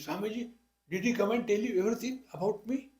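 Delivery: high, fast startled wife asking the swami; surprised wife. Retold or imitated. Wife, astonished the swami knows her husband, asks fast and high.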